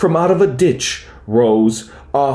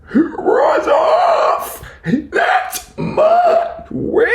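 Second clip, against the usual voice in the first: very high, shrieking monster cry; yowl and shriek; much slower than usual. A narrator's reading. The beast warns off the dragon, high shrieking yowl. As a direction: screech it like a horrible swamp beast. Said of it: "a yowl and a growl".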